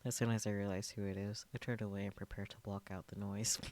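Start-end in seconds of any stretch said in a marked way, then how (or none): none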